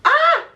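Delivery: very high, loud yelp; sudden shriek. Real, not acted. Vlogger fumbling the screen protector, high shriek. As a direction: let out a short, high shriek.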